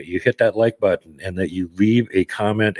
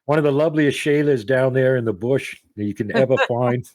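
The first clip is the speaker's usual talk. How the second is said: bad Australian accent; 5 semitones higher than usual. Host greets his Australian guest in a bad Aussie accent. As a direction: ham up an Aussie accent, knowing it's terrible. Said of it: "That accent needs work, mate".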